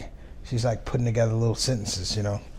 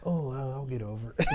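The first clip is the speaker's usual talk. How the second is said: laughing mock resignation; 2 semitones higher, 6 dB quieter than usual.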